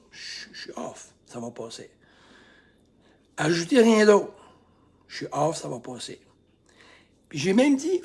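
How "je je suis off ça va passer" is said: whispered, lower admission; quiet admission. Shown as a demonstration. Coach modelling self-talk, whispered. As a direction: breathe it out softly. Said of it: "C'est ça".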